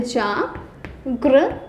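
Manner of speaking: जागृत drawn out slowly, ending on a rise; जागृत, slow and deliberate; slow clear pronunciation. Shown as a demonstration. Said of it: "जागृत होता है".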